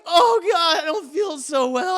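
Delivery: high, loud moan of nausea; hammed-up queasy complaint; mock sickly whine. Wife suddenly feels unwell, whiny acted moan. [name] feeling sick.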